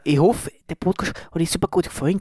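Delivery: put-on Tyrolean sign-off; Tyrolean accent. Host closing the podcast for listeners, Tyrolean accent. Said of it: "mach mal das Outro auf Tirolerisch".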